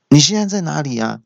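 high-pitched mock interrogation; questioning woman. Phone owner interrogating the man with her phone, mock demand. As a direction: imitate a woman demanding an answer. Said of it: "小姐就质问我说".

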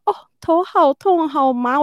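slow, high, loud unnerved moan; retold slow spooked moan; spooked groan. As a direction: feel the head spin from creepy news. Reacting to scary news.

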